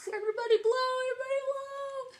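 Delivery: high, loud rallying call. Real, not acted. Teacher urging children to blow, excited. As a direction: cheer them on to blow. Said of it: "teacher urging kids".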